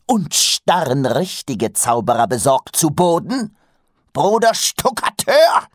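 high, loud stern reproach; single him out sharply; scolding shout. A narrator's reading. Master scolding another brother.

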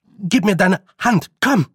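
high, loud, hurried plea; urgent command. Acted. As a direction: urge her to come right now. Boy urges girl to flee. (drängend und gehetzt)

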